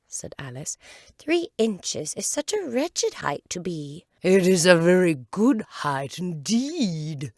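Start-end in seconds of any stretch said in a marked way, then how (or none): 1.2-4.1 put-on higher girl's voice; Alice complaining; a high voice, sorry for herself; young Alice. 4.2-7.3 slow, much louder caterpillar voice; an offended caterpillar, slow and loud; angry caterpillar. Acted. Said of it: "said the caterpillar angrily".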